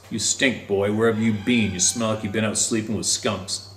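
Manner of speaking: ordinary delivery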